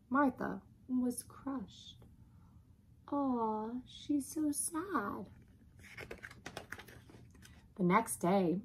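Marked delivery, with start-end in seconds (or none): 0.1-2.0 soft, slow, sorrowful narration; crushed. A narrator's reading. Narrator after the family scolds the dog, hushed and sad. 3.1-5.2 soft, high, slow sympathy; pitying coo. Acted. Onlooker on the crushed dog, tender pity.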